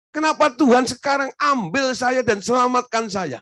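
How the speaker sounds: high, loud calling out to the crowd; preaching at full force. Acted.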